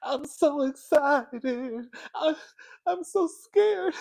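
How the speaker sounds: very high, drawn-out hammed-up wail; Jessie. Recalling Saved by the Bell, hammed-up frantic impression. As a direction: mimic a frantic singer, stretching every word high. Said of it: "She was like".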